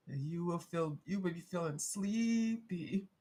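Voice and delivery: slow, drawn-out pretend hypnosis; playful fake hypnotist, lulling the cat; mock hypnotist. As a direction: stretch sleepy like a stage hypnotist. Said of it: "make you go to sleep faster".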